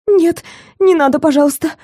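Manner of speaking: high, desperate begging; terrified pleading hostage. Acted. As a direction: plead with real fear. Hostage begs not to be drugged.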